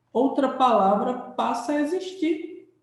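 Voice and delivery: usual speaking voice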